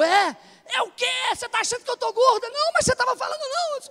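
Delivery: high, fast mock squabble; mock quarrel. Imitating husband and wife bickering, high and fast. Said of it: "Eu digo".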